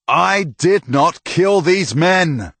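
slow, high and loud, each word pleading; slow desperate shout. Acted. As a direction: force every word over the crowd. Protesting innocence to the mob.

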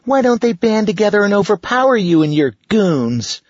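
put-on high, loud girl's voice; a bold little girl speaking up. Girl character voice.

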